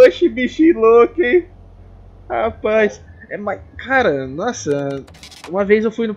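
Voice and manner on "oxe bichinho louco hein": higher, loud, drawn-out Baiano accent imitation; mock Baiano accent. Vlogger longing for the accent, mock Baiano accent. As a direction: call it out slowly in a hammed-up Baiano accent. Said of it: "ter um sotaque de baiano".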